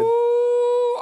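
high, loud squeal of delight; delighted ooh. Real, not acted. Host squealing with delight at the cheesy pizza slice.

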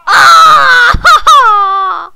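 loud, hammed-up scream; mock scream. Gamer screaming for the ragdoll as it gets hit, hammed-up. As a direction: belt out a cartoon scream of pain.

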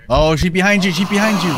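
high, loud urgent yell; shouting warning. Real, not acted. Friend spots ghost behind player.

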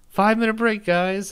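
high, loud announcement to the group; calling out. Retold or imitated. Bandleader telling the guys to stop, calling out.

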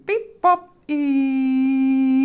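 high robotic beeping; robot beeps. Acted. Silly sounds page.

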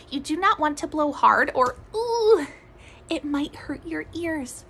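ordinary talk

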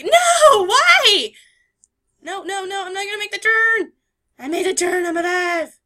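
loud high panicked cries; panicked yelling. Real, not acted. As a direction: shriek through the turn. Panic in the storm.

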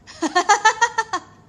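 loud high burst of laughter; big, loud cackling laugh. Real, not acted. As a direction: laugh out loud at your own joke. Jokes she'll keep a boyfriend.

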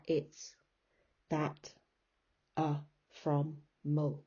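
"it's", "that", "A", "from", "mul" listed singly, lightly; unstressed syllables. Shown as a demonstration. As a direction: drop each of "it's", "that", "A", "from", "mul" as a small separate beat. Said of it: "And the unstressed syllables are".

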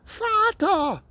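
high, calling cry for help; panicked shout. A narrator's reading. Boy stuck on the circling bike calls his father, high alarmed cry. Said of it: "Jetzt schrie er".

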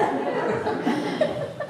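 quiet high airy laugh; high breathy laugh. Real, not acted. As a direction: laugh softly at yourself. Laughs at own story.

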